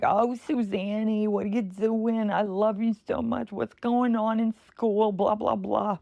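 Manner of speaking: high, slow doting New York grandma. Retold or imitated. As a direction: coo it like a loving old grandma. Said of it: "my grandma voice".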